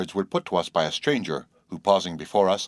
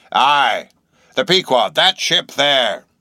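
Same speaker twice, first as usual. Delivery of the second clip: loud, raised seaman's voice; insistent old sailor; 6 semitones higher, 8 dB louder, much slower than usual. A narrator's reading. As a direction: drag out the last words emphatically. Stranger points at ship.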